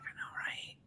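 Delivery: hushed pretend agreement; whispered reply. Answering the friends in a pretend whisper. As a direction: whisper it back conspiratorially.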